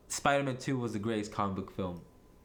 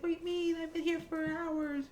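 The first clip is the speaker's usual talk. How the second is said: very high put-on whine; hammed-up indignation in a squeaky voice; mock complaint. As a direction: play an exasperated caller, pitched way up. Acting out the waiting character's complaint.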